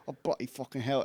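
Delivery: low, muttered British swearing; British grumble. Retold or imitated. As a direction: grumble softly like a British workman. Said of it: "British guy going like".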